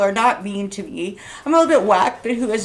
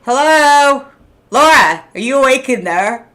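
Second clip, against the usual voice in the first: loud sing-song call, louder on each name; mock calling out; 5 semitones higher, 9 dB louder than usual. Mocking a pundit, calls her name loudly as if nobody's home. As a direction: call out as if knocking on an empty head.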